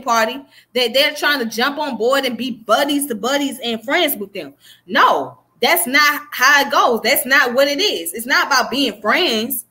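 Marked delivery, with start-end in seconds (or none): none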